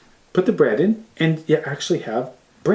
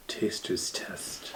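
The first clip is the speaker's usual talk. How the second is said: whispered aside; 6 semitones lower, 13 dB quieter, much slower than usual. Real, not acted. Quiet aside while cutting.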